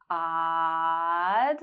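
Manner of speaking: long drawn-out ah in "Odd"; held ah. Shown as a demonstration. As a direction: stretch the ah of "Odd". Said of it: "hold ah for three seconds".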